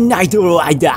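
exaggerated Aussie accent on an English name; Australian accent. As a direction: put on an odd Australian accent. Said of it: "Con ese acento medio raro que tienen los australianos".

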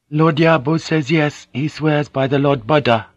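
a courtly lady relays her lord's word; Mariko voiced. A narrator's reading.

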